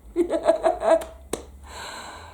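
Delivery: an airy laugh; breathless emotional laugh. Real, not acted. Recalling the author's delighted words, laughing breathlessly. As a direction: let the joy spill out as a laugh.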